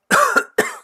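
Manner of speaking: loud acted coughing fit; sharp, loud coughs. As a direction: cough hard, as if just coming to. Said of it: "You can hear Wilfred coughing".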